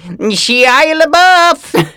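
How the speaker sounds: very high, loud, drawn-out holler; yelling. Acted. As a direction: yell the name down a busy street. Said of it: "just keep yelling".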